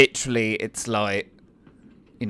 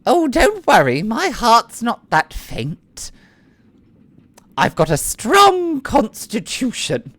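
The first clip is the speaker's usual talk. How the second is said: much higher, slow and flirtatious noble lady; Annette's voice; 7 semitones higher than usual. Acted. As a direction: lay on the charm, slowly.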